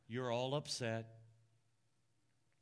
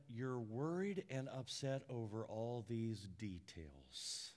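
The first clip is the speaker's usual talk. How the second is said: soft, low, slow loving reassurance; Jesus lovingly calming an anxious Martha; tender Jesus; 6 semitones lower, 8 dB quieter, slower than usual. Acted. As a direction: reassure her gently, almost a whisper. Jesus gently addressing Martha.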